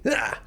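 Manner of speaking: short, high rock-singer "ah"; gnarly rock cry. Shown as a demonstration. Voice coach demonstrating intense rock singing, gnarly cry. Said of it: "rock stuff that seems a bit more gnarly".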